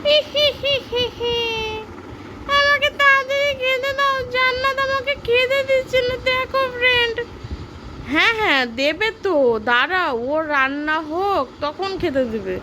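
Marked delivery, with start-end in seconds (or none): 0.0-7.2 squeaky giggling doll begging; high doll voice. Pretend. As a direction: giggle then whine like a tiny doll. Toy friend giggles, begs food. (উঁচু পুতুলের গলায় আবদার)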